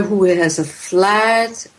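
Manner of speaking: ordinary talk, nothing marked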